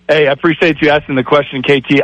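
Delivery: usual speaking voice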